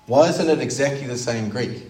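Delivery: ordinary delivery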